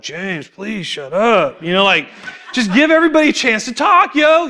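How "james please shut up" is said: slow, drawn-out mock plea to stop talking; hammed-up groan of a tired group; exasperated plea. Group members begging talkative James, slow mock exasperation. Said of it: "They're like".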